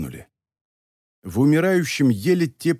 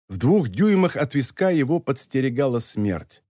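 ordinary delivery, nothing marked

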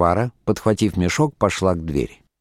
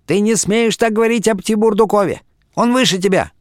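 very high-pitched indignant outcry; sudden wail; 12 semitones higher than usual. A narrator's reading. Wife suddenly defends her lover, wailing high. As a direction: burst out in a high, scolding wail. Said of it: "и вдруг заголосила".

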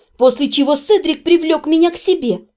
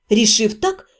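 plain reading voice

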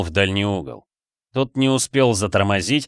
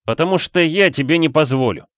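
high, tense angry refusal; angry hiss; 7 semitones higher, 4 dB louder than usual. A narrator's reading. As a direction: forbid it with heated force. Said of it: "зашипел Флинн, точно рассерженный змей". (сердито, с нажимом)

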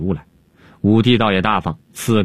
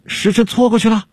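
panicked, much higher voice; alarmed cry; 13 semitones higher than usual. Acted. Alchemist panics that the hour has passed, high alarmed question. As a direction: gasp it out in a high, alarmed pitch. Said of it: "方术士惊慌地问".

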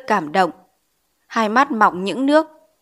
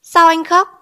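raised voice, much higher and louder; loud, surprised question; 8 semitones higher, 6 dB louder than usual. A narrator's reading. Teacher puzzled by pupil's sobbing, loud surprised question. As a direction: ask loudly, taken aback. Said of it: "Ông giáo lấy làm lạ hỏi to".

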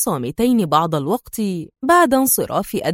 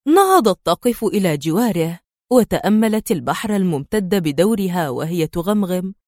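ordinary delivery, nothing marked

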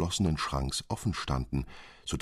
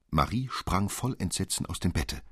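usual speaking voice

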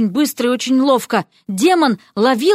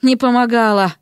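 usual speaking voice

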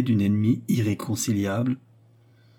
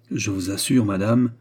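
ordinary delivery

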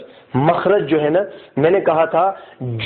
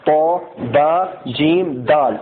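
slow letter recital; 2 semitones lower, slower than usual. Shown as a demonstration. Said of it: "یہ حروف قلقلہ ہیں".

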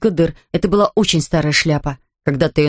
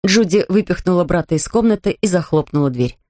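usual speaking voice

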